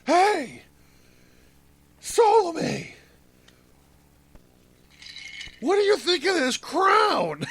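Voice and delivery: high strained holler to someone far below; hammed-up long-distance shout, pitched very high; mock screaming. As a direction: yell down comically from way up in the air. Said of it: "screaming down to Salome".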